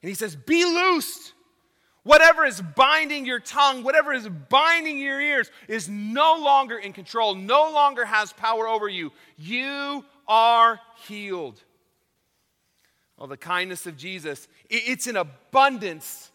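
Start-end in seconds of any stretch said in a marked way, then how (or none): 0.4-11.6 high, loud, ringing command; commanding proclamation. Retold or imitated. Preacher voicing Jesus commanding the man's ears and tongue open, loud and high. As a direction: declare the healing with full authority.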